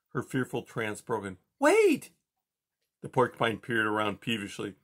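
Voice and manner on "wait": very high, loud plea; calling out. A narrator's reading. Poppy begs the porcupine to stay, shouting high. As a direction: stop him before he goes. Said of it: "Poppy cried".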